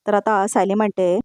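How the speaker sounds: plain reading voice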